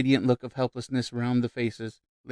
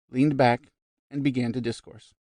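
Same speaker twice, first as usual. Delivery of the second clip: ordinary delivery, nothing marked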